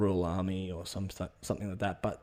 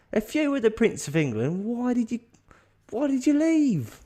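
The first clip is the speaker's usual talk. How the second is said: very high, incredulous mock query; hammed-up bewildered mate; baffled questioning; 13 semitones higher, 3 dB louder than usual. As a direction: stretch the last question out high. Mate puzzled why Harry left.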